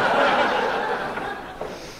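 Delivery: breathy laugh at his own joke. Real, not acted. Author laughing at his own joke, warm laugh. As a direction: chuckle into the mic.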